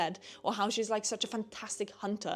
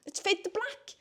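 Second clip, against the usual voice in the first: rising higher and higher in mock outrage; indignant rant; 11 semitones higher than usual. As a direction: climb in pitch with each indignant line. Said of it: "And I'm like".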